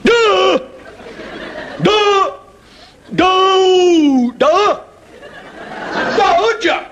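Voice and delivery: put-on high squeaky kid blending letters, giggling; little kid proudly getting it wrong; high giggly toddler voice; child sounding out.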